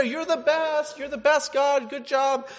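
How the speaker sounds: high-pitched gushing praise; eight-year-old's thanks. Acted. Preacher mimics shallow praise to God, childlike gushing. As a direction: gush like a child at Christmas. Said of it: "like this eight-year-old thanking his grandparents".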